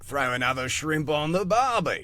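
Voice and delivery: hammed-up Australian, pitched high; broad cartoon Aussie catchphrase; Australian accent. Actor mocking their only attempt at Australian, hammed-up accent. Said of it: "never done an Australian accent before, except for".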